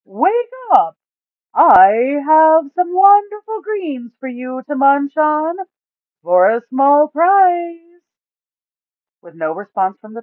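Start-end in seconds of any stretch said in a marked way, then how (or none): 0.0-7.8 high, loud, slow voice of big Duke; booming Duke. A narrator's reading. Duke laying out the plan to the party, loud and slow. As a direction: call it out big and slow, stretching every word.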